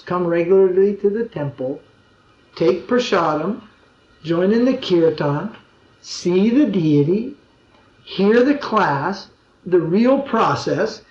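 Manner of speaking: measured, step by step, with pauses; slow listing. Real, not acted. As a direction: spell out each step slowly and deliberately. Listing the proper steps.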